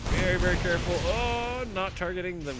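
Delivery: loud, driving esports commentary; live play-by-play. Real, not acted. Caster narrating the battle to viewers, loud driving call. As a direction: keep the energy up through the fight.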